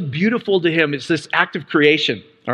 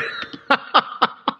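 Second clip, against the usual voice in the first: high, airy burst of laughter; breathy real laughing fit; 7 semitones higher, 4 dB quieter than usual. Real, not acted. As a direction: crack up helplessly at your own joke. Cracks up at own innuendo.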